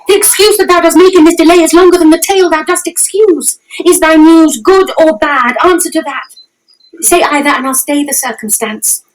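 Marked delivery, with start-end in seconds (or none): 0.1-6.0 impatient. Acted. Juliet retorts rapidly.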